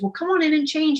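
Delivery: high-pitched, eager retold host; eager invitation. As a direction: imitate him cheerily ushering a guest in.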